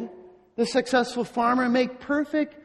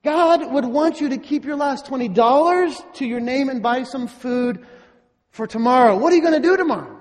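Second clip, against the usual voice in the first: mock financial counselor scolding; 3 semitones higher, 4 dB louder than usual. Counselor to poor widow giving away her last cents, incredulous. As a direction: lecture a client who makes no sense. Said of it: "You'd probably say".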